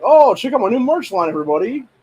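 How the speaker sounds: high, loud mock-excited girly sales pitch; mocking quote. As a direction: ham up a fake cheerful plug to sell merch. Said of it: "she's like".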